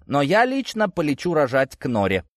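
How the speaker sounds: high-pitched animated declaration; exclaiming. A narrator's reading. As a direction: pipe up eagerly, then drop on the name. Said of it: "воскликнула Мария".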